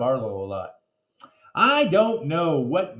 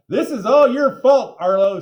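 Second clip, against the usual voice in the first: loud, higher blaming shout; accusing; 10 semitones higher, 13 dB louder than usual. A narrator's reading. Girl loudly blames Arlo for the fainting. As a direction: point the finger and yell.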